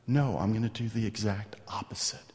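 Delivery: soft unvoiced whisper; hushed, tender promise to the men; whispered reassurance. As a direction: breathe it like a secret promise. David reassuring his men, whispered.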